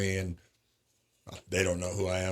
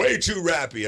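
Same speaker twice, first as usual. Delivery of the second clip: high, louder mock of the boss; 8 semitones higher, 5 dB louder than usual. Boss dismissing the song, mocked high and loud. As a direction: mimic the boss sneering at rap, pitched up. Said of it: "And he's like".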